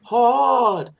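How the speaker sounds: high, slow, heavy Jersey drawl on "hard"; young Jersey voice whining to dad about hard pretzels; thick Jersey accent. Retold or imitated. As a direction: imitate his old self stretching "Hard" high and slow. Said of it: "watched this old video of myself".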